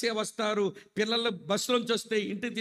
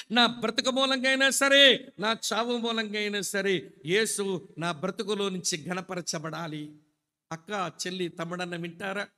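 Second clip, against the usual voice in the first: ordinary talk, nothing marked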